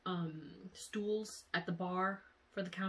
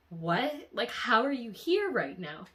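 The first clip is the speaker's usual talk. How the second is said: louder incredulous retelling, pitch jumping up; retold shock; 4 semitones higher, 6 dB louder than usual. Questioning him standing alive before her, retold shock. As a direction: relive the stunned disbelief, hitting the question hard. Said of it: "I was so shook".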